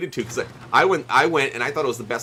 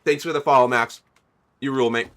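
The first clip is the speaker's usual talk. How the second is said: ordinary talk, nothing marked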